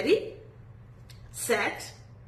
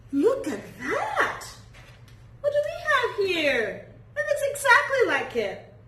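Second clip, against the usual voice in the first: mock surprise; 11 semitones higher than usual. Teacher praises child's drawing.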